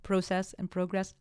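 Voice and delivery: "Process" and "progress" both with a long O; Canadian-style "Process and progress", first vowels as in "go"; Canadian pronunciation. Shown as a demonstration. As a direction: say PRO-cess and PRO-gress. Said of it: "that's how they say it in Canada".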